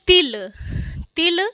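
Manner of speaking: crisp short "til" for तिल. Shown as a demonstration. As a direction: punch तिल with a quick short vowel. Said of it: "ता पे छोटी लगी है".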